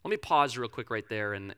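ordinary talk, nothing marked

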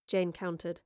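usual speaking voice

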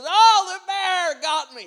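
high loud hammed-up cry of defeat; mock dying cry. Knocked-down grandpa laughing on the floor, high loud mock cry. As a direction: play the dramatic victim. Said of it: "then he says".

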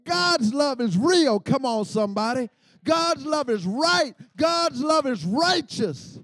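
high, loud, insistent preaching; emphatic preaching. Real, not acted. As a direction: hammer each phrase home to the congregation. Preacher refutes doubts at full force.

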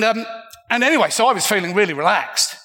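normal talking voice

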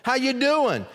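put-on old friend thrilled at the door; delighted greeting. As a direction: call out in happy surprise.

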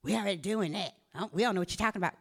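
low, soft mock of stubborn folk; dismissive people. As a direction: mimic a brush-off under your breath. Mimics people who brush off warnings.

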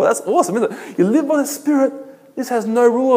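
delighted, giggling over the good news; laughing with joy. Real, not acted. Preacher laughs with joy telling church about freedom, laughing.

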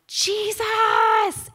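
long, high-pitched scream for help; acted scream. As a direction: scream the name out, stretched and loud. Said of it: "I would go to scream".

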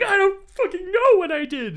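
put-on high, loud, angry drunk whine; mock drunken yell. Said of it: "I'm like".

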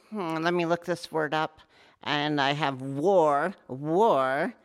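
a lightly voiced pompous jargonist, slowing on war; hammed-up know-it-all looking a word up; mock jargonist. Teacher mocking a jargon-bound Aikidoka looking up words. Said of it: "The jargonist's gonna go".

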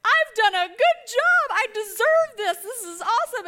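high, loud, slow and elated; elated, proud mother; tearful elation. Retold or imitated. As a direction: savor it, overcome with pride. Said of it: "I was like".

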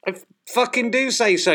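high, sharp furious retort; angry outburst. Acted. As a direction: snap back in a rage. Swearing at the assistant.

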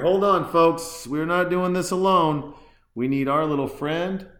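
rally the listeners like a showman; excited call-out. Real, not acted. Host announcing to listeners he needs a beer, high loud call.

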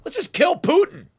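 high, airy mock of a flippant warmonger; sarcastic high-pitched send-up of the hawks; mocking quote. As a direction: ridicule them by sounding carelessly cheerful. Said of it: "Now they're just saying".

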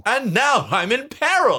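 high, hammed-up damsel cry; Misty. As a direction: announce danger in a shrieky mock lady voice. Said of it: "The police lady".

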